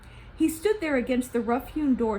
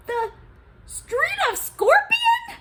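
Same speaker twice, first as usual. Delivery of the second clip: shocked gasp, much higher and louder, very slow; 8 dB louder, slower than usual. Acted. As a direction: gasp out the name in horror. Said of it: "Gozan gasped".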